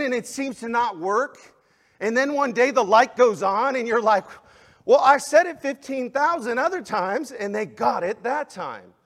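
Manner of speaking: high, loud preaching that builds to each point; fiery preaching. Acted. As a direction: drive it home from the pulpit like a revival preacher. Pastor preaching at full force.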